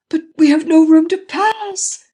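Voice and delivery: very high, loud and slow terror; panicked shriek. A narrator's reading. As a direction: scream it in panic at the crash ahead. Panicking passenger.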